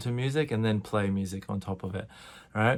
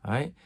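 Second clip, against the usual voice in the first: tired sigh; 3 semitones higher than usual. Shown as a demonstration. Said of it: "We go".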